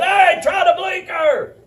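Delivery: high, slow mock yell; retold angry holler. Woman shouting from her car at the pastor, mock angry yell. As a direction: play the mad driver hollering out her window. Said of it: "she hollers out the window".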